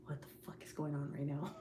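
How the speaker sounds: breathless unvoiced whisper; whispered aside. Pretending to see a ghost kid, whispered. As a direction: hiss it like you can't believe it. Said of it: "I'd be like".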